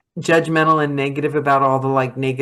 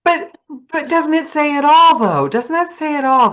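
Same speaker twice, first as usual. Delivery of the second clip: delighted; 11 semitones higher than usual. Real, not acted. Host delighted by her guest's cart story, gushing high. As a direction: gush over the story, pitched way up. Emotional, laughing.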